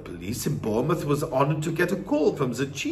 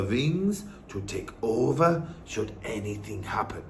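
put-on lower, measured old-woman voice; wise Norwegian grandmother; 5 semitones lower than usual. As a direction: explain gravely as the grandmother. Grandmother explains to boy.

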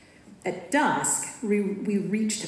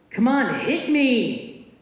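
loud, high taunt; goading shout; 6 semitones higher, 5 dB louder, much slower than usual. A narrator's reading. Marnie shouts at the narrator to hit her. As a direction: yell at her to throw a punch.

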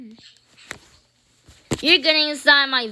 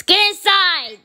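loud, higher mock-villain command; playful shouted order; 4 semitones higher, 5 dB louder than usual. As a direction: yell it as a pretend captor. Playing clown ordering captives in.

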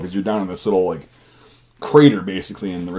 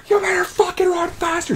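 much higher screeched order; screaming drill sergeant. Retold or imitated. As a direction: shriek at the running recruit. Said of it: "They're like".

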